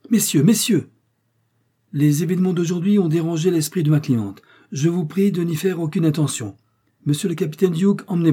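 high, urgent courtroom appeal; urgent protest. A narrator's reading. Lawyer excusing his client to the court, urgent. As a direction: break in high and loud, pleading for attention.

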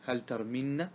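ترمين as tarminna, held doubled n; emphatic nun. Shown as a demonstration. Teacher emphasises for Hind, doubling the n of ترمين. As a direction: shorten the i and hold nn in ترمين. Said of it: "ثم أكد".

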